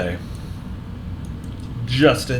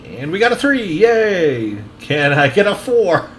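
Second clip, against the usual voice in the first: gleeful cheer; 7 semitones higher, 3 dB louder, slower than usual. Real, not acted. Cheering at loading progress.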